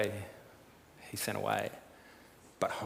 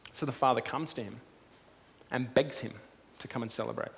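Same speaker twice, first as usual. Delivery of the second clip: ordinary talk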